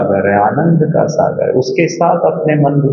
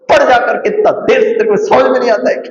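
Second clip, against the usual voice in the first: much higher, fast furious scolding; angry mother scolding; 10 semitones higher, faster than usual. Retold or imitated. Mother losing her temper and slapping her son, shouting high and fast. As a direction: snap at the child like a fed-up mother. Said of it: "आपने तो बच्चे पे गुस्सा कर दिया".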